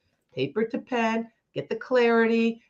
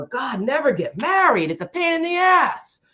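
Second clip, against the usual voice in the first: rising, louder, fed-up dad voice; exasperated father, much higher by the end; dad grumbling; 6 semitones higher, 4 dB louder than usual. Retold or imitated. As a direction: play the dad griping to his brother. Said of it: "you hear him go".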